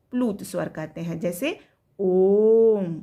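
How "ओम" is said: ओम held long and loud, the ओ stretched far beyond a long vowel; prolonged vowel. Shown as a demonstration. Teacher demonstrates a prolonged vowel to pupils, ओम stretched and loud. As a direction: chant ओम, drawing the ओ out at full voice. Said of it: "तो इन दोनों से हमारा मुंह ज़्यादा उच्चारण करने में खुला".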